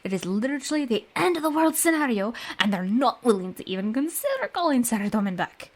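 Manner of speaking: fast, exasperated knight's rant. Acted. Knight complaining to a fellow adventurer about rigid rules, fast and exasperated. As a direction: rattle off the grievance as an indignant knight.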